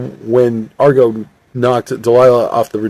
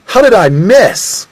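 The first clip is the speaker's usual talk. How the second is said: high, drawn-out exclamation; acted exasperation, pitched high; frustrated disbelief; 10 semitones higher, 4 dB louder, slower than usual. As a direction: wail it in disbelief at a missed shot. Shooter's shot is blocked.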